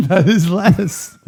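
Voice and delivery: laughing. Real, not acted. Laughing at the joke.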